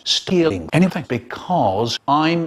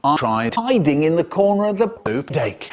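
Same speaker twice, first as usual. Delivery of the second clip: plain reading voice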